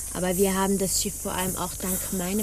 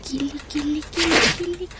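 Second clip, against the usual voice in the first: rising higher sing-song coo; teasing tickle call; 5 semitones higher, faster than usual. Acted. Tickling the prisoner's nose with straw, teasing.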